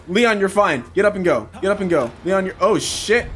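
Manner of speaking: normal talking voice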